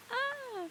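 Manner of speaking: high, soft squeal; excited squeal held down in volume; stifled freak-out squeal. Real, not acted. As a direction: squeal quietly so it stays hushed. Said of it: "I can't be really loud".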